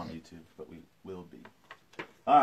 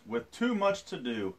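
storytelling; 3 semitones higher than usual. A narrator's reading. Reads picture book aloud.

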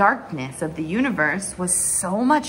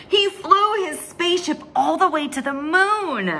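excited; 6 semitones higher, 3 dB louder than usual. A narrator's reading. Dream of flying spaceship.

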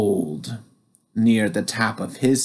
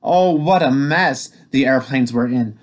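usual speaking voice

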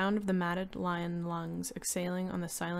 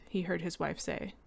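plain reading voice

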